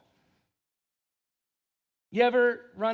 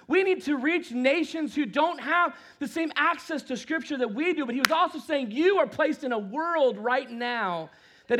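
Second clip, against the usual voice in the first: preaching; 6 semitones higher, 5 dB louder than usual. Acted. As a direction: preach it to the congregation. Preaching at full force.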